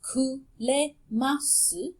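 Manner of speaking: kuremasu said slowly, syllable by syllable; Japanese word. Shown as a demonstration. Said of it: "The verb".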